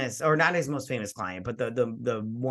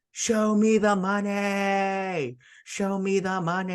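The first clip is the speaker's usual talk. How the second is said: slow, stretched, higher and louder mock yell; 8 semitones higher, 4 dB louder, slower than usual. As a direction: belt it like Jerry screaming into the phone. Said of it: "starts yelling at the top of his lungs".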